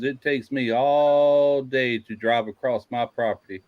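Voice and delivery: long, drawn-out ALL in "all day"; slow storyteller's brag, dragging the word "all"; boastful rancher. Retold or imitated. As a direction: stretch "all" way out, as a retold brag.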